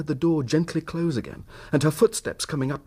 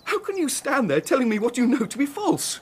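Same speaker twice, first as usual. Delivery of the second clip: raised, high accusing voice; angry accusation; 10 semitones higher than usual. Acted. Husband accusing his lying wife outside the cottage, raised and angry. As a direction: confront her with her lie.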